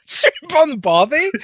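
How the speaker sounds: high, drawn-out mocking question; incredulous mocking echo. Co-host mocking the accent attempt, incredulous high-pitched echo.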